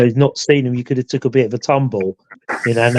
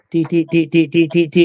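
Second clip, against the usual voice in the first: raised repeated beeping; imitating an alarm beep; 4 semitones higher than usual. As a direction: mimic the rem bear going off. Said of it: "their rem bear went off".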